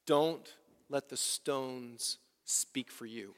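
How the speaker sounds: slow, soft and intimate; a quiet solemn farewell charge; hushed charge. Acted. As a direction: lean in and say it gently. Pastor's last word, hushed.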